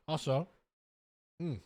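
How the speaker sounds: talking with a mouthful of brownie; mouth full. Real, not acted. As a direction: talk through a bite of brownie. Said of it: "I had to get that brownie done".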